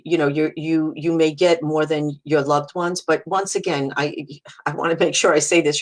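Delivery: ordinary delivery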